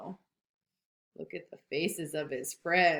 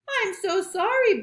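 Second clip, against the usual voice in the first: high, slightly loud sorry; high apologetic Rabbit; 12 semitones higher, 5 dB louder than usual. Acted.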